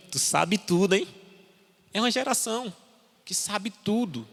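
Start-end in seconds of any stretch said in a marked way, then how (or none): 0.1-1.1 repreensão. Retold or imitated. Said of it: "Aí ele olhou pra mim".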